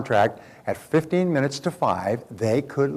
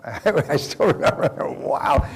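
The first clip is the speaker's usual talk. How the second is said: chuckling disbelief, higher and drawn out; amused laughter; 4 semitones higher, much slower than usual. Real, not acted. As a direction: laugh through the words, slowly. Chuckles recalling the mass walkout.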